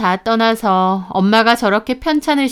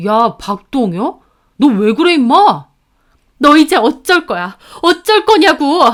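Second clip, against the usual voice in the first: heated, loud demand building higher; angry confrontation; 6 semitones higher, 6 dB louder than usual. A narrator's reading. Sister confronting her brother about their mother, angry yelling. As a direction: demand answers, building to a yell. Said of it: "제가 소리를 지르며 이름을 부르자".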